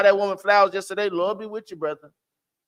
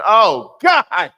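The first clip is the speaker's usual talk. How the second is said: loud, drawn-out praise; swelling louder praise; fervent cry; 2 semitones higher, 9 dB louder, much slower than usual. Real, not acted. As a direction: call it out like a hallelujah. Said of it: "No attitude".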